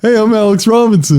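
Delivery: self-impression pitched high; playing himself. Alex hamming up his own voice for the host. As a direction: overdo your own voice like a caricature. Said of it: "Now you're playing it".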